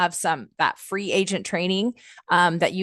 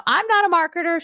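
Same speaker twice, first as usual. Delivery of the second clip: put-on high, loud Southern-accent quote; playful Southern accent imitation; 12 semitones higher, 4 dB louder than usual. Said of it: "She's got this Southern accent".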